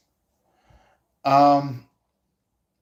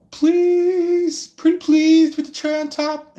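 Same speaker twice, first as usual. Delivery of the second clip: much higher, louder wheedling plea; begging flirt; 5 dB louder than usual. Retold or imitated. Tarot reader mimics a stranger begging for attention. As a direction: beg sweetly like a spoiled flirt. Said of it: "They're like".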